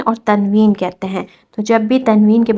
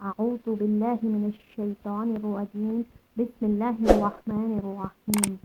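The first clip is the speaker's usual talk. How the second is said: quiet, slow devotional recitation; soft slow recitation; 9 dB quieter, slower than usual. Acted. Teacher opening the lesson, slow soft recitation. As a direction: recite the opening prayers slowly and softly.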